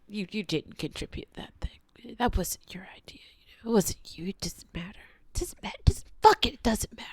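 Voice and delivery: hushed, seething under the breath; bitter whisper. Real, not acted. Ranting to camera about credit, in a seething whisper. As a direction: whisper it like you can barely contain it.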